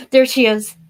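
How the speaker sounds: loud, delighted "There she is!"; excited greeting. Real, not acted. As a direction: call out happily on finding her. Spotting friend Misty in chat.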